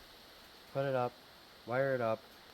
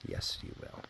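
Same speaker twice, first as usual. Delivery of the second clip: whispered praise; 10 dB quieter than usual. Real, not acted. Soft words to puppy.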